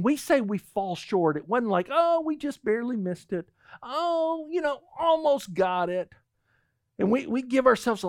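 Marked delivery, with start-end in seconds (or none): 1.9-6.1 higher, breezy shrug; mock casual excuse. Preacher mocking how we excuse sin, higher and breezy. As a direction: brush it off lightly.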